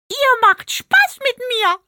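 very high, slow pig voice with amusement; laughing pig voice. A narrator's reading. Pig realises the girls are teasing, very high and amused. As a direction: say it with a giggle like the little pig. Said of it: "stellte Einschwein lachend fest".

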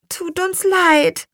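put-on high, slow girl's apology; drawn-out, remorseful little-girl voice; contrite. A narrator's reading. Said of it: "sagte Lea zerknirscht".

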